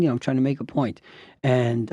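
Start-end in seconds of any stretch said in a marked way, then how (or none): none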